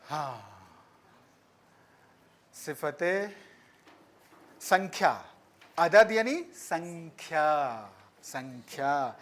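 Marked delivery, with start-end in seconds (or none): none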